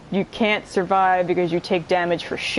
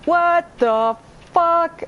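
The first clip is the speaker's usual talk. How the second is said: slow, drawn-out, louder and higher disbelief; stunned shout; 9 semitones higher, 3 dB louder, much slower than usual. Real, not acted. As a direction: stretch it out in shock. Shocked again, slow.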